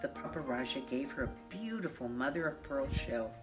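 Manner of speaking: usual speaking voice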